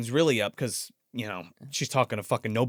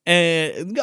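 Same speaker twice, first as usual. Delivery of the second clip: ordinary talk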